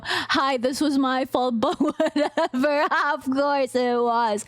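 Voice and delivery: ordinary talk, nothing marked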